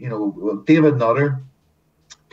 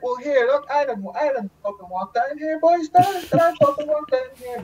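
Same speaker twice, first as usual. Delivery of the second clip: defensive protest. Retold or imitated. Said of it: "I was like".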